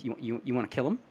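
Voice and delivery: quiet, low deadpan question; dead serious. Retold or imitated. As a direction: ask it dead straight, no smile. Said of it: "looks at me dead serious".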